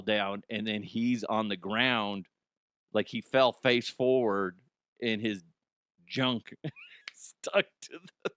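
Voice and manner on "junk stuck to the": whispered, laughing. Real, not acted. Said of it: "whispered mid-story".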